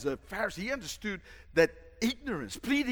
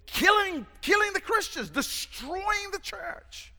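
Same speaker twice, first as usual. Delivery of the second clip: emphatic shout; 14 semitones higher than usual. Real, not acted. Preacher stresses Saul's mission.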